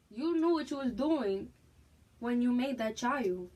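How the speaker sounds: loud, emphatic telling-off; scolding. Real, not acted. Mother confronting the absent father over their child, loud scolding. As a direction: confront him loudly, punching the words.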